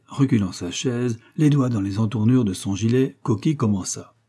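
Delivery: plain reading voice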